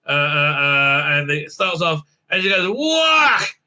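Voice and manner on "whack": high, punchy mock fight "whack"; cartoon blow effect; shouted whack sound. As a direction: call out the hit, pitched way up. Acts out the blow.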